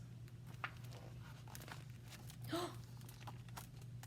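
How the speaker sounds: soft gasp. Acted. Story gasp, bear's idea.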